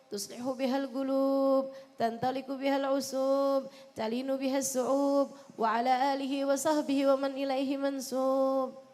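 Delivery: measured, rhythmic Arabic prayer chant; salawat recitation. Shown as a demonstration. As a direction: recite evenly, holding each line's rhyming ending.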